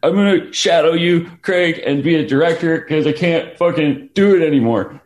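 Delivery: high, loud panicked wail of despair; retold hysterical sobbing. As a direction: play it like you're falling apart in tears. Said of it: "I was hysterically sobbing".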